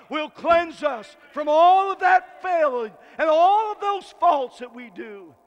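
loud, slow shouted preaching; preaching at full force. Acted. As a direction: drive it home with everything you've got. Preacher shouting about the blood.

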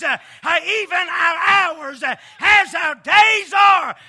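loud rhythmic sermon cadence; preaching at full force. Acted. Preacher rousing his church about strength, full-force whooping delivery. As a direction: pound out each line to the congregation.

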